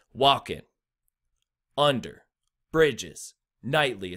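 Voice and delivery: one word at a time, first syllable hit in "walking", "under", "bridges", "nightly"; trochaic stress. Shown as a demonstration. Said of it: "a stressed, then an unstressed syllable".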